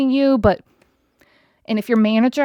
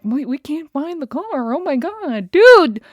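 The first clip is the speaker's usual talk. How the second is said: mock panic; 6 semitones higher, 4 dB louder than usual. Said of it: "Because they're like".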